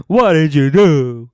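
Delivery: overacted outraged demand; mock scenery-chewing yell. Cena yelling at Del Rio, hammed-up and loud. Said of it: "Cena is chewing scenery".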